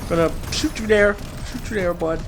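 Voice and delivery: ordinary delivery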